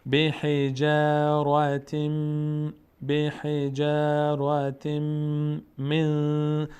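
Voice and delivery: slow drawn-out tajweed chant; Quran recitation. Shown as a demonstration. Teacher reciting the verse for the class. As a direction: recite الم یجعل slowly and evenly.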